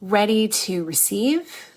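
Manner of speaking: slow meditation guide with long pauses; guided meditation. Acted. Coach guiding listeners into a meditation, guided meditation. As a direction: ease listeners in slowly, phrase by phrase.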